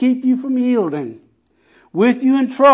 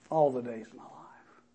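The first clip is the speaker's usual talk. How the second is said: soft reverent; 6 semitones lower, 12 dB quieter than usual. Acted.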